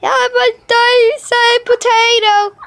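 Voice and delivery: high, goofy put-on voice; silly voice. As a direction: blurt it high in a cartoonish voice. Silly outburst in pretend play.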